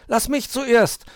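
high, loud demanding cry; a kitten pushing to be first; shouting. A narrator's reading. Mauz yells to get the telescope first, loud. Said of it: "schrie Mauz".

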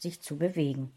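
plain reading voice

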